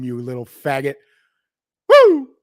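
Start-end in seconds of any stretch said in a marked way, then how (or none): 1.9-2.3 high, loud victory whoop; whooping yell. Real, not acted. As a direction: let out a wild whoop. Triumphant yell after rant.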